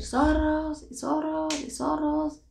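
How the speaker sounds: high-pitched repeated call; imitated cry into the distance; retold calling out. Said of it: "Empezaron a gritar y a decir".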